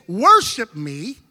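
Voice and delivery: God's slow demand for worship; slow, weighty. Retold or imitated. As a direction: lean hard into each word. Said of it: "when he says".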